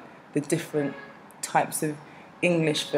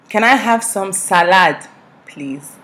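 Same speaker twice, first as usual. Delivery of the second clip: loud, higher Nigerian-accented request; broken-down Nigerian English; 4 semitones higher, 8 dB louder than usual. Shown as a demonstration. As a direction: spell it out loudly in a Nigerian accent. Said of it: "I can break down English".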